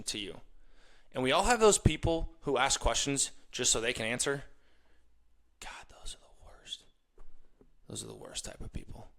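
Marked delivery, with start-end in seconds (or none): 5.6-9.1 whispered aside. Real, not acted. Host whispering to listeners about annoying people. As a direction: hush it like a confession. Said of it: "whispered aside".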